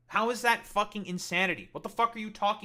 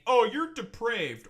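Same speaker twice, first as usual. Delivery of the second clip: higher, louder, drawn-out mock accusation; mocking accusers; 5 semitones higher, 6 dB louder, slower than usual. Streamer imitates chatters deflecting from his points, in a high mocking voice. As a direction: parody a scandalised critic, stretching it out. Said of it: "deflect to saying".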